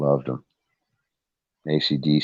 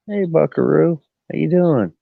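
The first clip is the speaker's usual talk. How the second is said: high, louder sing-song pet talk; cooing at the cat; 13 semitones higher, 4 dB louder than usual. Real, not acted. Owner greeting his nineteen-year-old cat on camera, high sing-song cooing.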